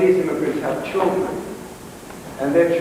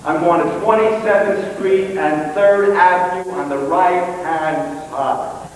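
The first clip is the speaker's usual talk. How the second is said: loud, higher, over-enunciated address; emphatic repetition; 4 semitones higher, 4 dB louder, slower than usual. Acted. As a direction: spell it out loudly for someone not understanding. Repeats address after "What?" and "Pardon?.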